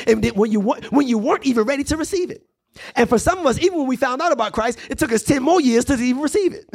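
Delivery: high, rhythmic sermon cadence; impassioned preaching. Real, not acted. Preacher at height of refrain.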